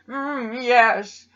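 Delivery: hammy German villain voice; slow, sneering German accent; movie villain German accent. Pretend. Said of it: "I'm gonna do my German accent".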